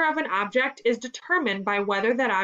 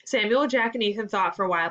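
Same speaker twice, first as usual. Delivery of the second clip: ordinary delivery, nothing marked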